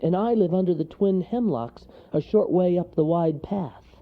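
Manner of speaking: small character piping up; mild shout. A narrator's reading. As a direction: call out directions eagerly. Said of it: "shouted Wharton".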